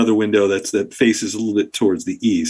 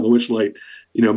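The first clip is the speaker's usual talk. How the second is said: ordinary talk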